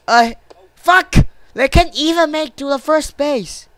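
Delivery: high-pitched, frustrated cursing; exasperated outburst. Real, not acted. Player cursing runners who can't reach first base, exasperated outburst.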